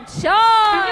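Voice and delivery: loud arena shout; shouted start call. Real, not acted. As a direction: yell it to start the match. Match start countdown call.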